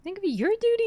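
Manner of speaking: a high, hammed-up mock of a stuffy knight; mocking echo. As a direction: parrot the pompous line back at him in a high mock voice. Mockingly echoes the knight's line.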